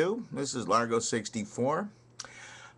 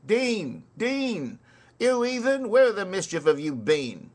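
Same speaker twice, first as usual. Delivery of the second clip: high, louder scolding call; a touch of hammed-up soldier impatience; impatient calling; 9 semitones higher, 6 dB louder than usual. Soldier scolding the late water carrier, high impatient call.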